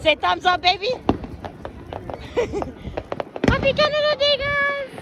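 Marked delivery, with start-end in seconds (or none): 3.5-4.9 high holiday shout-out; celebratory high-pitched greeting; excited cheer. Real, not acted. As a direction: call it out high to the viewers. Holiday greeting called to viewers.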